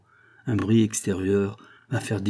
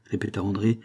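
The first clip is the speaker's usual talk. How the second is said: plain reading voice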